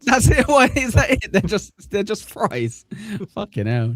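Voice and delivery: high, incredulous talking through laughter; squeaky-high exasperated giggling; cracking up. Real, not acted. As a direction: laugh helplessly as the words come out. Host cracks up over the fries.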